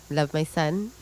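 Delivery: ordinary talk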